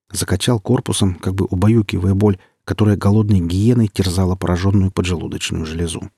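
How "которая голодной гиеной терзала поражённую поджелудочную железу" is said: plain reading voice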